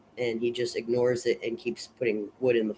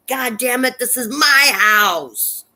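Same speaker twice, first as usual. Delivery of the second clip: high, loud and slowly drawn-out shout; furious scream; 12 semitones higher, 6 dB louder, slower than usual. Retold or imitated. George defends his home against the house, furious slow shout. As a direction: claim your home back, bellowing at it. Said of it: "he screams at Wrath again".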